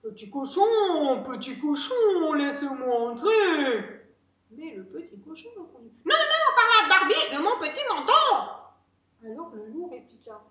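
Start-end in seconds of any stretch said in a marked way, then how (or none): 0.0-4.1 loud, drawn-out wolf call; big bad wolf demanding entry. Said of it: "il s'écria". 6.0-8.3 high, loud, fast piglet squeal of defiance; shrieky little pig voice; little pig refusing. Acted.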